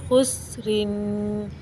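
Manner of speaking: खुसरिन slow with a steady, unbounced सीन; no qalqala on seen. Shown as a demonstration. Teacher shows the right खुसरिन, सीन left still. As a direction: hold सीन smooth, no jolt, in खुसरिन. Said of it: "तो सीन को बिल्कुल नहीं हिलाएंगे".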